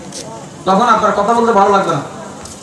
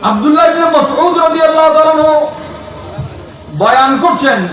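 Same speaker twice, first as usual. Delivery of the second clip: preaching proclamation; 5 semitones higher than usual. Real, not acted. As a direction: proclaim it to the whole gathering. Proclaims the hadith's meaning.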